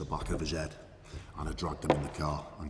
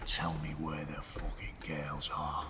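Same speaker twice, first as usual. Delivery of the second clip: menacing whisper; 2 semitones lower, 6 dB quieter, slower than usual. Retold or imitated. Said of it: "And I said".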